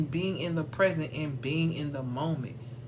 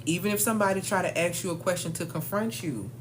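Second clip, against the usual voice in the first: ordinary talk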